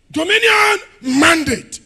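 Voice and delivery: loud, high, shouting preacher; fiery preaching. Acted. Preacher rouses church about dominion, shouting loud and high. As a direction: hammer each line at the congregation.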